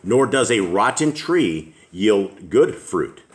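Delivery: normal talking voice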